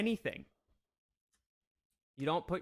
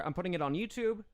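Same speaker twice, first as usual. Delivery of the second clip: ordinary talk, nothing marked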